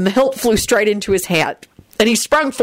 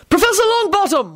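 high, ringing announcement; announcing; 14 semitones higher than usual. Acted. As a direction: call it out. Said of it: "one of the men announced".

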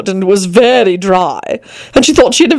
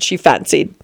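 usual speaking voice

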